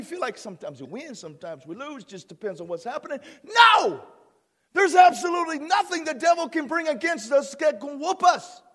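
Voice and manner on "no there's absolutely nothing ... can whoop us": loud, declared with full force; preaching shout. Shown as a demonstration. Pastor repeating his point louder so the church hears it. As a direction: hammer the line home to the crowd. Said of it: "I want you to hear that".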